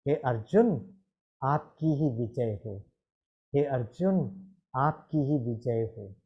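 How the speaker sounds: Bhishma blessing Arjun. Retold or imitated. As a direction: bless him gently from the deathbed. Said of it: "वो अर्जुन को कहता है".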